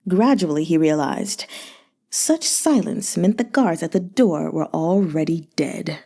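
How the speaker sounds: ordinary delivery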